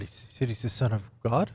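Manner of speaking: hushed, questioning echo with a rise on God; stunned question. Acted. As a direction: repeat it softly to yourself in disbelief. Pilate repeats it to himself, questioning.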